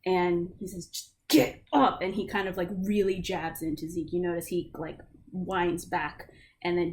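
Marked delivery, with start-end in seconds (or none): 0.9-2.0 much louder, higher snapped command; angry order. Acted. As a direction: lose your patience and shout it at him. Guard losing patience.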